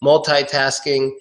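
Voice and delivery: "multitasking" as mul-TIE-tasking; MUL-TIE variant. Shown as a demonstration. Teacher lists three ways, multitasking with TIE. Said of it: "three ways to say that word".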